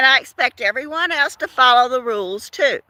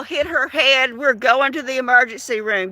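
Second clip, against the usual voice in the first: usual speaking voice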